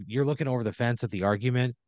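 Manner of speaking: normal talking voice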